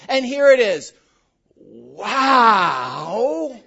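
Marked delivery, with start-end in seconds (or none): 1.6-3.6 "Wow" stretched into three syllables, loud and swooping; drawn-out wow. Shown as a demonstration. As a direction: stretch "Wow" long, rising and falling in awe. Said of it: "Make wow into a three-syllable word".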